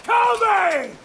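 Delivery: a furious taunting yell; defiant shout. Acted. Prisoner goading the captain to arrest him, an angry shout.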